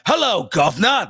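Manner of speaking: hammed-up, high-pitched Cockney greeting. Shown as a demonstration. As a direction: overdo a bad Cockney accent. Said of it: "where everybody immediately thinks of Cockney".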